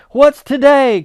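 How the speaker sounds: loud high call. Acted. Scrooge calling from the window to a boy, loud. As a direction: call down to the street. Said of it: "cried Scrooge, calling downward to a boy".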